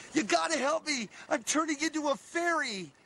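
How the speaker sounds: high, desperate cry for help; frantic plea. Retold or imitated. Man panicking at the sales lady, high frantic plea. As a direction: beg the clerk in a panic. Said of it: "I run up to her and I say".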